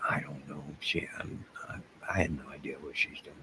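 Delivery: breathy whispered aside. Real, not acted. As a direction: drop to a hushed whisper, as if not to be overheard. Aside whispered while chat reacts.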